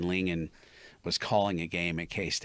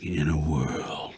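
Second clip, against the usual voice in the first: low, slow airy trailer voice; movie trailer voice; 5 semitones lower, much slower than usual. Shown as a demonstration. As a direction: breathe it close to the mic. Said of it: "you gotta kinda do that airy voice".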